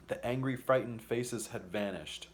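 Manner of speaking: ordinary delivery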